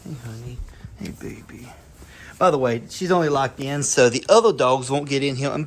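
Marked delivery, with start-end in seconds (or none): 0.0-1.7 much softer breathy whisper to the dog; whispered coo. Real, not acted. Owner greeting nursing mother dog, soft whisper. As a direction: barely voice it, soothing the mother.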